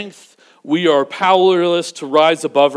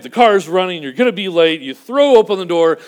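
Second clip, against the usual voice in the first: ordinary talk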